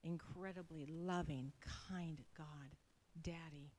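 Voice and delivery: soft, hushed closing prayer. Real, not acted. Woman gently closing her prayer to God. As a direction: pray it softly, almost whispered.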